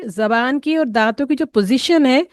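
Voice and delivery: usual speaking voice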